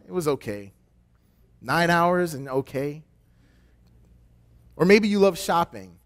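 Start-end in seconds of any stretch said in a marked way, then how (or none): 0.1-0.7 flat, unimpressed. Retold or imitated. Flat, tired reply.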